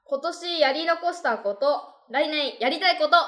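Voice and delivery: high, slow title announcement; high cute anime girl. Acted. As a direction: announce the theme sweetly.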